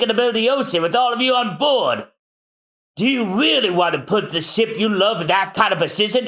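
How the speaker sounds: high, heated back and forth; heated argument. Acted. Frankie and Usopp quarrel over the ship, high heated.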